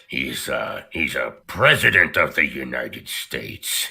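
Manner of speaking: put-on low, gravelly old president voice; a growl of old Reagan energy; gritty old president. Shown as a demonstration. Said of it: "And he sounds like this".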